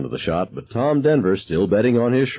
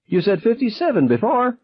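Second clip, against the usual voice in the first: piercing yell, far higher; screamed. Acted. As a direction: catch him out with a scream. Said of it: "I screamed".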